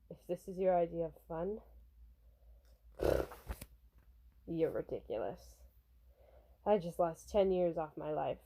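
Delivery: understated, worn-out low grumble; weary bodyguard. Acted. Bodyguard scolding pop star after the chase, low and weary.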